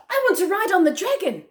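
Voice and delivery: put-on high, eager child asking; a little kid begging for the dragon; child's voice. A narrator's reading. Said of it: "said one".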